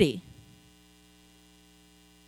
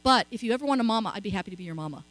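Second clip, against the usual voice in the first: 1 tender; 3 semitones lower, 7 dB quieter, faster than usual. Retold or imitated. Tender offer to grieving refugee.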